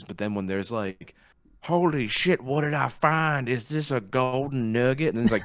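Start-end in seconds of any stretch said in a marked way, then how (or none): 1.6-5.1 high, loud fake excitement at a discovery; mock amazed gasp. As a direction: ham up the amazed reveal. Mocking a documentary reveal.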